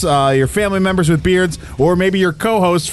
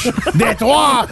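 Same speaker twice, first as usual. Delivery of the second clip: higher, hammed-up French 'Detroit'; French accent; 5 semitones higher than usual. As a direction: punch the name out in mock French. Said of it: "make that more French".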